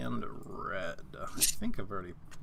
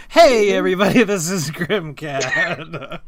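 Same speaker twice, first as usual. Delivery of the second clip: intro spiel; 5 semitones higher, 13 dB louder than usual. Acted. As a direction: boom out the channel intro. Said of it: "can you tell people my intro spiel".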